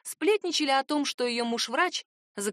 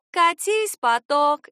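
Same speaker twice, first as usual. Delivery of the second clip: loud, drawn-out team cheer; футбольный клич; 6 semitones higher, 8 dB louder, much slower than usual. A narrator's reading. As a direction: chant it high and slow like a fan. Чужой футбольный клич в диалоге. (протяжный громкий клич болельщицы)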